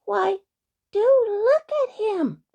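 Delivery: high, loud pixie exclamation; astonished cry. A narrator's reading. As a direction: cry out in amazement, drawing the words out. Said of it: "cried another pixie".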